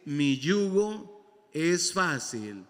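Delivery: each word slow and deliberate; slow recitation. Shown as a demonstration. Preacher slowly reciting the verse to the congregation. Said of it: "dice así de esta manera".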